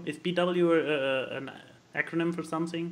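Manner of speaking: usual speaking voice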